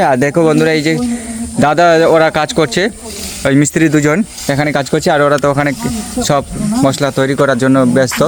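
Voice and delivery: normal talking voice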